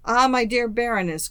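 exclaimed with lively gusto; hearty cry. A narrator's reading. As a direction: cry out a delighted hail to a friend. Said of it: "cried a young man".

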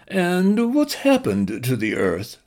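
high, slow posh nasal voice; plummy nasal voice. Acted. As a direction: read it like a stuffy Englishman. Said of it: "in a plummy nasal voice".

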